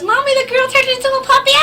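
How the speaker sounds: put-on very high, loud little-girl voice; toddler voice. Toddler telling mommy what happened in the movie, tiny high voice. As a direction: squeak it out like an innocent two-year-old. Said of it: "She's, like, barely two".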